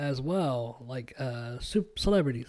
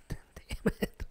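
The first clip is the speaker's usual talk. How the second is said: unvoiced-sounding whisper under the breath; retold hushed curse, barely voiced; muttered curse; 7 dB quieter than usual. Parent retells nearly being caught crying by son, whispered curse.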